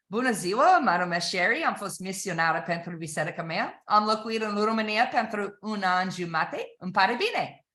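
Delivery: speaking Romanian as a demo; a greeting in Romanian to show the language; spoken Romanian. Shown as a demonstration. To sales learners, a short Romanian self-introduction. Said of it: "It might sound like this".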